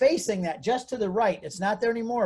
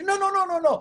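much higher, frantic run of nos; panicked no; 13 semitones higher, 4 dB louder than usual. Retold or imitated. Counselor stopping him from calling the minister, frantic high nos. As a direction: blurt it out in a panic to stop him.

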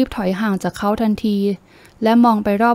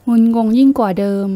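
plain reading voice

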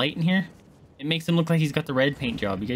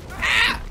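pained yelp; 4 dB louder than usual. Real, not acted. Getting hit in game.

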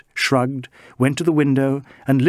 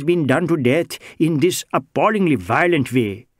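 put-on higher, lilting Indian-accented voice, anxious and earnest; Bengali social worker; 5 semitones higher than usual. Bengali social worker protesting to the inspector, higher Indian-accented voice. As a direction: play the mild Bengali gentleman pleading his innocence.

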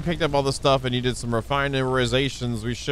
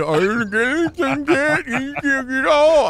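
high, slow, whiny mock of the partner; mocking whiny complaint; 12 semitones higher, 4 dB louder, slower than usual. Mocking his partner's complaints in a high whine. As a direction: drag it out like a kid complaining. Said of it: "I'm like".

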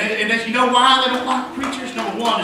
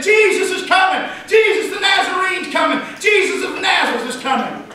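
put-on boys' excited shouts; 8 semitones higher than usual. Little boys announcing Jesus to the town, excited shouting. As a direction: call it out high as if running ahead. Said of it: "little boys running ahead of him saying".

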